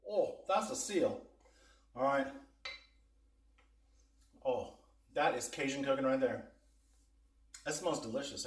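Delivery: ordinary talk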